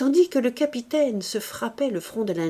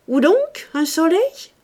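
higher, louder, feigned astonishment; mock surprise; 9 semitones higher, 7 dB louder than usual. A narrator's reading. Captain teasing Mouret, mock surprise. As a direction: play dumb with wide-eyed wonder.

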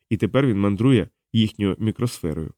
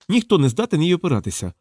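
mildly snappish, higher-pitched retort; raised pitch, a touch of irritation; 6 semitones higher than usual. A narrator's reading. Diana retorts to her companion about the drug, mildly snappish. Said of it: "огризнулася Діана".

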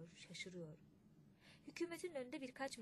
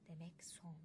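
soft, lower whisper of defeat; whispered inner thought; 4 semitones lower, 7 dB quieter, slower than usual. A narrator's reading. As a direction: say it to yourself, crushed. Kaymakam's bitter inner thought. (kendi kendine fısıltı)

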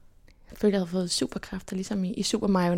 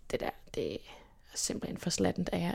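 scolding classmates, much lower and softer; quiet scolding; 3 semitones lower, 9 dB quieter than usual. Retold or imitated. Scolding classmates after the emergency, in a low soft voice. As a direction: tell them off softly and low. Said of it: "skulle man sådan".

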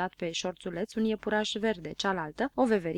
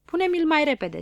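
fretful urging; 8 semitones higher, 5 dB louder than usual. A narrator's reading. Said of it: "se frământa Ochi Albaștri".

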